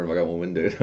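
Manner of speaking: ordinary delivery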